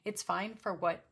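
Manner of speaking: normal talking voice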